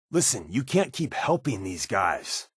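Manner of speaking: normal talking voice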